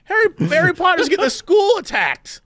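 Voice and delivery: high, exasperated scolding; annoyed student rant. Pretend. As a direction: scold them like a fed-up classmate. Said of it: "I'd be like".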